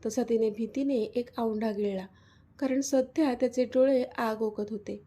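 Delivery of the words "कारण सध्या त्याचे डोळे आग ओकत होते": usual speaking voice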